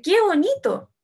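high, loud exclamation of acted delight; entusiasmo. (exclamación entusiasta, aguda y fuerte)

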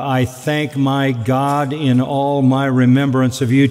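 ordinary talk

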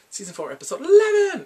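loud, high-pitched episode shout; big high shout to open the show; excited announcement. Real, not acted.

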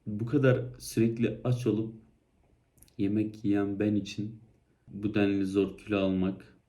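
ordinary talk

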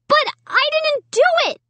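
very high wailing plea. A narrator's reading. Amy wailing her innocence to Mom, high wail. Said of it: "I wailed".

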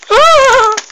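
very high loud squeal; excited shriek. Real, not acted. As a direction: squeal with delight. Excited at instructions.

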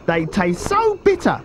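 high-pitched croc complaint; not-so-big crocodile. A narrator's reading. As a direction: object in a high small-croc voice. Smaller crocodile objects.

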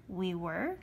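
small rising question; puzzled surprise. A narrator's reading. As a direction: echo it, caught off guard. Boy surprised by friend's lie.

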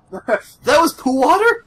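high, loud, drawn-out complaint; mock outraged yell. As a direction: yell up to Ma in disbelief.

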